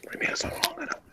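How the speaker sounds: quick soft whisper; hushed fast ASMR voice. Pretend.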